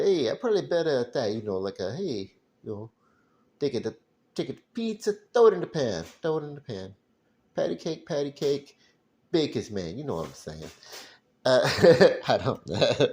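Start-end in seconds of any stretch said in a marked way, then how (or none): 0.0-1.3 lightly voiced, higher mobster; a quick hint of a mobster voice. Trying out a mobster voice he might do better, mock mobster. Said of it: "like, a mobster". 2.0-10.0 high, hammed-up mobster voice. Mobster telling someone to throw pizza in the pan, hammed-up. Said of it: "like, a mobster".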